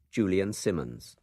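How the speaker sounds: ordinary talk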